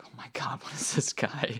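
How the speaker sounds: imitated aghast aside under the breath; retold whisper. Nurses walking in on the chanting husband, whispering. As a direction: whisper it like a baffled onlooker.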